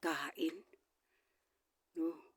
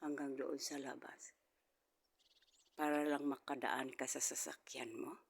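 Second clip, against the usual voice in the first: ordinary delivery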